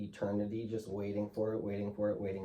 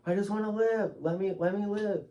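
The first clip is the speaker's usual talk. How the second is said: high, loud retold plea; retold begging to the nurses, high and loud; pleading cry; 11 semitones higher, 3 dB louder than usual. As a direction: cry out to be let live.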